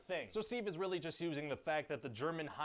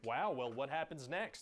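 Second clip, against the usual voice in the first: usual speaking voice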